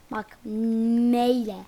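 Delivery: "M ile" stretched slow and loud; slow emphatic spelling. Shown as a demonstration. Telling viewers the name is spelled with M, slow and emphatic. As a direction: make sure they get the letter M. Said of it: "N ile değil M ile".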